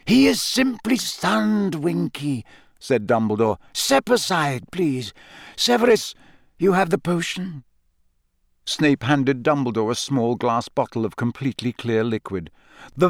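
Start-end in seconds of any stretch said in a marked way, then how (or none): 0.0-7.6 lightly voiced kindly old wizard; gentle, knowing old professor; wise old headmaster. Dumbledore voiced by narrator.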